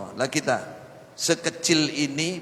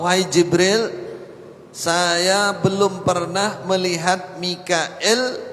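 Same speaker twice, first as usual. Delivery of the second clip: emphatic quoted address; 5 semitones higher, 4 dB louder, slower than usual. Acted. Prophet's words voiced in sermon.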